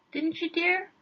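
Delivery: high, polite hand-off of the question; husband turning to his wife for help. Acted. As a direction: pass it to her sweetly.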